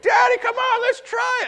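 put-on high, loud begging kid voice; excited little kid shouting. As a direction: plead like an eager child to his dad. Kid begging dad to try.

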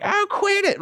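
mock squeal of annoyance; frustrated kid. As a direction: imitate a pestered schoolboy. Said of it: "I'm getting so frustrated".

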